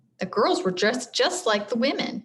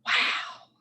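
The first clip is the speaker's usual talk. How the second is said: soft breathy whisper; 3 dB quieter than usual. A narrator's reading. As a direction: whisper it in wonder. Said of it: "whispered others".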